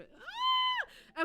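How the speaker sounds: sudden very high squeal. Real, not acted. Streamer nervous about sour candy, high squeal. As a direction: squeal mid-sentence.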